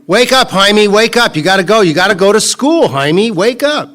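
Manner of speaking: loud, high call to wake a sleeping son; dad calling out. Retold or imitated. Dad waking son in story.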